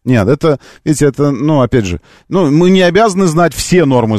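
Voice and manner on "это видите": ordinary talk, nothing marked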